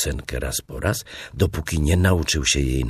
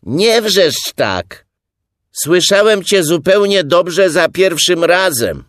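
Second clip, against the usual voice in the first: loud, high complaint from inside a burrow; high, raised-voice telling-off; shouted scolding; 11 dB louder than usual. A narrator's reading. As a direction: yell back to stop the yelling. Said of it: "ten sam głos dodał".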